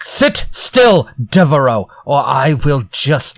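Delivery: pin him in place with menace; angry command. Acted. Acted threat in role-play.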